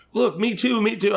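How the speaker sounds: put-on high, eager little-girl clamor; excited kids. Four girls showing him their photos, high eager excitement. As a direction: imitate children jostling to be noticed.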